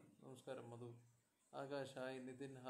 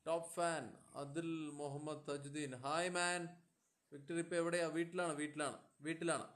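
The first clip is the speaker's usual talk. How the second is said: raised voice to carry; calling out; 2 semitones higher, 6 dB louder than usual. Real, not acted. Streamer greeting fans over weak audio, voice raised. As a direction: project to the viewers.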